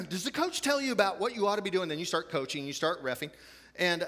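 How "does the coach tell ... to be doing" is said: much higher, eager rapid-fire question; excited parent. Retold or imitated. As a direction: gush like a parent reliving the game. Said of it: "And you're all excited".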